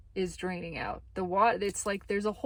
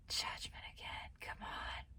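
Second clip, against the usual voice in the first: breathy aside, unvoiced, to herself; real whisper; 15 dB quieter than usual. Real, not acted. Reader whispering at the card she drew again, exasperated.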